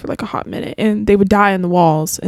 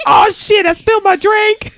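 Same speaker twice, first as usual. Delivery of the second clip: high-pitched imitation of angry cursing; mock angry; 11 semitones higher, slower than usual. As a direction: imitate a dad cursing over a spill. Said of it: "the way he was so angry".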